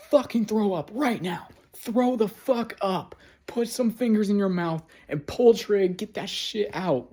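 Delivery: high-pitched, furious urgent command; losing it. Retold or imitated. Demanding she throw up the pills, losing it. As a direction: order it out, pitch way up, at the end of your rope. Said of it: "like, losing it".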